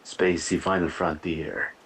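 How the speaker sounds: slow, cartoonish French accent; slow French accent, hammed up; mock French accent. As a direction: intone it like a Pink Panther Frenchman. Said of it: "in a French accent to see how it would sound".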